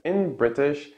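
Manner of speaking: usual speaking voice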